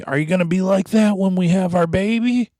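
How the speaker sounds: high, whiny accusing wife; mock nagging wife. Hard Hat's wife accusing him, high whiny voice. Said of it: "she had the nerve to say to me".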